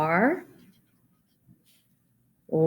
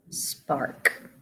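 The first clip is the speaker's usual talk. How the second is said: whispered word; 3 semitones lower, 7 dB quieter than usual. Shown as a demonstration. Teacher whispers word after sounding out.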